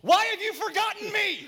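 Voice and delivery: high, full-force cry of protest; anguished shout. Acted. Psalmist demanding an answer from God, shouting. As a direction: yell it out at God.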